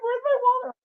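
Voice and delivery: hammed-up, high-pitched panic; panicked wail. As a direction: act out losing it over something missing. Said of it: "I'm panicking".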